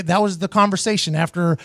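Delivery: ordinary talk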